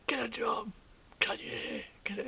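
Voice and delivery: loud mock yell of a passing driver; imitating a heckler's shout; retold yelling. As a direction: bark it like a jeering motorist out the window. Passers-by yelling from cars.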